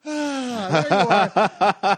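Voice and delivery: high, drawn-out cry of relief breaking into laughter; mock relief. Customer finally spots his real weed guy, laughing mock relief. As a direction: gush it high, playing hugely relieved. Retold relief at finding right dealer.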